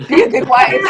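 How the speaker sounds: laughing self-reminder. Retold or imitated. Wife reminds herself to listen to her husband, loud laughing. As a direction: scold yourself to behave, laughing through it.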